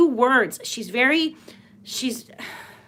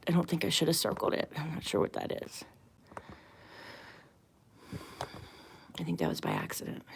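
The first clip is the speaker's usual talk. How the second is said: much softer, lower, half under her breath; muttered aside; 5 semitones lower, 15 dB quieter, faster than usual. Real, not acted. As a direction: talk to yourself, barely audible. Muttering to herself off-topic.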